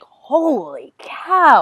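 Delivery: very slow, drawn-out amazement; awestruck. Retold or imitated. Guest reacts as the actress opens the door, awestruck. Retold awe at star's entrance.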